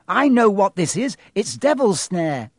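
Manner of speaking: put-on girl recognising the plant; urgent. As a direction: announce it urgently. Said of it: "Hermione ordered them".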